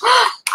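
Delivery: sharp high gasp of realization; excited gasp. Real, not acted. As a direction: gasp as an idea hits. Sudden idea.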